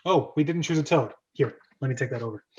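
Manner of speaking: ordinary talk, nothing marked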